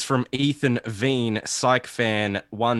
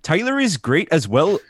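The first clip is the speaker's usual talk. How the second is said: put-on Canadian accent, pitched up; 4 semitones higher, 4 dB louder than usual. Host reads a fan's review aloud in a put-on Canadian accent. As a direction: read it in a mock Canadian accent. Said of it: "I should be reading this in a fucking Canadian accent".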